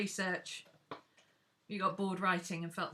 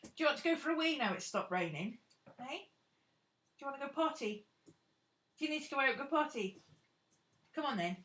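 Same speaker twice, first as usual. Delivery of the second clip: higher, gentle coaxing of the dog; pet talk; 5 semitones higher, faster than usual. Real, not acted. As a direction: coax the dog out kindly. Asking dog about potty.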